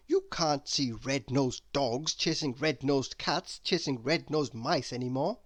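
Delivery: ordinary delivery, nothing marked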